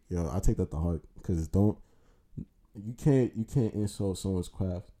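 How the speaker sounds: ordinary talk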